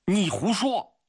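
very high, outraged cry; sudden shout. Acted. Grigory accuses the killer of lying, sudden shout. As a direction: burst out in angry disbelief. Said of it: "突然大声地喊了起来".

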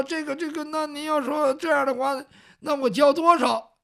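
high, halting voice of a cornered peddler; hesitant peddler. Acted. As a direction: stall politely before asking. Peddler stalls before the bully's demand. (支支吾吾地问)

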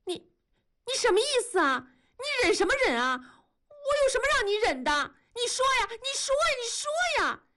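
very high, fast, insistent challenge; furious demand. A narrator's reading. Wife glares and challenges her husband, shrieky rising demand.

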